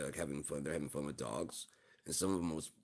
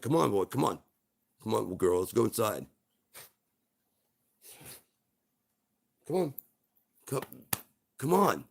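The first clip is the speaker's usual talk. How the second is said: louder, higher coaxing call; calling the dog; 5 semitones higher, 8 dB louder than usual. Acted. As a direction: call a pet back indoors. Owner calling the dog inside.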